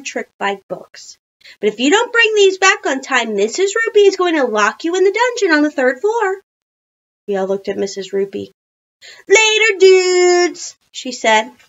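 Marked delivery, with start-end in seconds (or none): 1.6-6.4 high, loud mock threat; warning teacher. A narrator's reading. 9.3-10.8 high, loud biker-dude goodbye; called-out farewell. A narrator's reading. Trick-biker character calls goodbye leaving.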